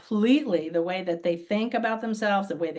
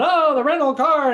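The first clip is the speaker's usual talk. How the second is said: mock complaining tourist; 6 semitones higher, 8 dB louder than usual. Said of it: "he's bitching and moaning to his wife".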